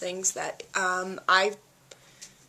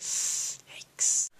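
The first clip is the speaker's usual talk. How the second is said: whispered, softer yikes; embarrassed whisper; 5 dB quieter than usual. Real, not acted. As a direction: breathe it out in embarrassment. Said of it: "embarrassed that I just did that".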